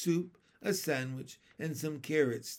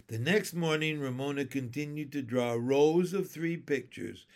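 plain reading voice

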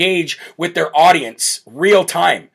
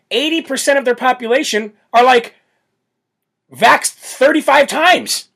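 sarcastic; 7 semitones higher than usual. Real, not acted. Sarcastic exaggeration.

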